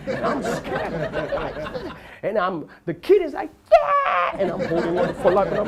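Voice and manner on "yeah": loud high-pitched imitated kid's scream; child's scream. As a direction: yell like a kid on a thrill ride. Child yelling on the ride.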